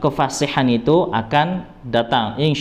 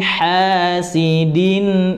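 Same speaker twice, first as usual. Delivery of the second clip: slow, drawn-out chanted recitation with long held nasal sounds; Quran recitation; 4 semitones higher, much slower than usual. Acted. Teacher reciting the verse aloud for students, slow melodic chant. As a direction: recite it like tajwid practice, each sound stretched.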